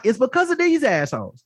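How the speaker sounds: normal talking voice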